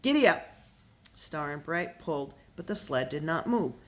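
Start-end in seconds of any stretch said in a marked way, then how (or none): 0.0-0.5 loud, high call to the steers; shouting at oxen. A narrator's reading. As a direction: yell it out to get the team moving. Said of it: "cracked his whip and shouted".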